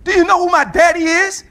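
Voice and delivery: preacher shouting defiance to the congregation; full-force preaching. Acted. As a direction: shout it out like a proud child of a strong father.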